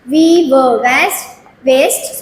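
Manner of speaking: drawn-out slow We were waste; very slow syllable reading. Shown as a demonstration. Teacher to nursery pupils, reading waste very slowly. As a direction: spell it out very slowly for little ones. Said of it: "with syllables".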